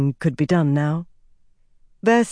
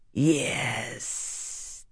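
soft, long sibilant hiss; 3 semitones lower, 9 dB quieter than usual. A narrator's reading. Dark figure satisfied with the count, hissing. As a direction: hiss it quietly, stretching the final s. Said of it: "the figure hissed".

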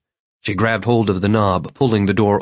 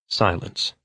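usual speaking voice

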